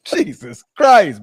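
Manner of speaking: ordinary talk, nothing marked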